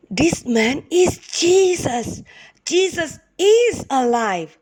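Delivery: high, slow, joyful exclaiming; joyful amazement. Acted. Helpers realising the visitor is Jesus, slow and overjoyed. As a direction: marvel that he lives.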